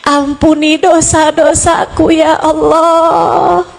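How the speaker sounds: acted plea for mercy, long drawn-out vowels; slow, imploring prayer on a sustained note; tearful supplication. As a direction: plead with God as if weeping. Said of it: "dengan linangan air mata kamu bilang".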